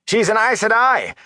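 very high, heated outburst; angry. A narrator's reading. Farmer protesting to the innkeeper about her, angry. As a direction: protest angrily, pitch shooting up. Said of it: "Hari began angrily".

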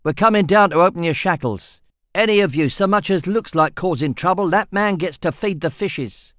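barking orders. A narrator's reading. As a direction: bark it at the prisoners. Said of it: "he barked at them".

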